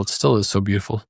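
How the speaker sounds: choked up, fighting tears; emotional, voice close to tears; after crying. Real, not acted. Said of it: "I'm getting teary".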